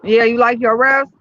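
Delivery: usual speaking voice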